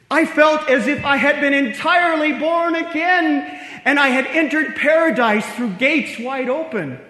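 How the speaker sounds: loud, high, elated declaration; joyful exultation. Acted. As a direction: proclaim it as a rapturous revelation. Luther recounts joyful spiritual rebirth.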